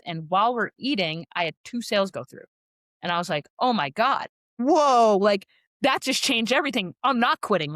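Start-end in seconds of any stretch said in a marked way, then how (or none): 3.6-7.7 bursting with sudden excitement, louder and higher; excited. Retold or imitated. To friends at the taco joint, excited. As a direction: whoop over the surprise sales. Thrilled at first two sales.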